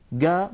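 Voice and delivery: "جا" said as hard "ga"; a hard g standing for qaf; dialect g sound. Shown as a demonstration. Teacher describing other tribes' sound, hard g. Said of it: "تجعل نفس الصوت".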